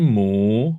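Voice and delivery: MU long, dipping then rising; drawn-out scooped MU; third-tone demo. Shown as a demonstration. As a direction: swing MU slowly upward. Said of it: "ini ada tiga".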